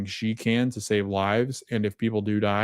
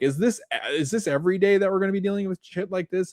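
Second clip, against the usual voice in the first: lightly voiced higher, fast, incredulous new doctor; overwhelmed newbie; 9 semitones higher, faster than usual. Retold new doctor asking his colleague, higher and fast, incredulous. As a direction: sound swamped by the chaos. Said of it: "having the conversation of".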